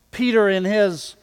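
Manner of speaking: ordinary delivery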